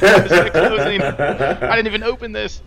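frustrated inner voice. Retold or imitated. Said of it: "It's like".

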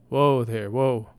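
loud, firm call of whoa; steady the bolting horse with a strong call; calming a horse. Acted. Damsel calling out to the spooked horse, loud and firm.

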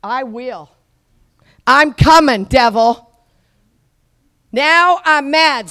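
slow shouted now I'm mad; defiant preacher taunting the devil; mock defiance at devil. Shown as a demonstration. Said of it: "I say".

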